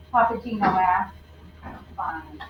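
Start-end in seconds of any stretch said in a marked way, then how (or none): 1.9-2.4 low, soft sad mumble; a quiet sulky mumble; mumbled. Acted. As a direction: barely say it, upset. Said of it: "she mumbled".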